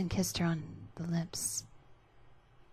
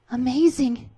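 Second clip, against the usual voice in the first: smiling; 7 semitones higher, 11 dB louder than usual. Acted. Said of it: "Alice said as she smiled back".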